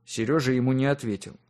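ordinary delivery, nothing marked

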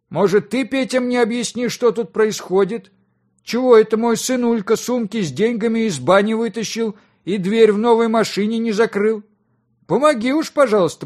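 high, loud and exasperated; indignant demand. A narrator's reading. Father demanding that his guest explain the mess, exasperated. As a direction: press for answers, pitch kept high.

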